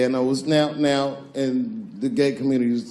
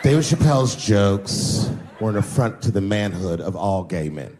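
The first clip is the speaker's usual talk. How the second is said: made-up blogger voice; 6 semitones lower than usual. Retold or imitated. Comic inventing a blogger's voice to mock him, low and pompous. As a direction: play a self-important critic. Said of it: "I'm just making this voice up".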